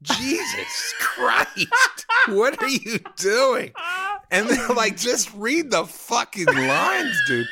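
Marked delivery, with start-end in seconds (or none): 0.0-3.7 high, drawn-out cry of disbelief; mock horrified outburst, stretched out; exasperated shout. Film crew aghast at his audition. 5.0-7.5 high, loud, slow plea; mock director at wit's end; exasperated shout. Film crew losing patience with him.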